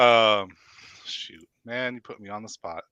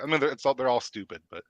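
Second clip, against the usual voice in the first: normal talking voice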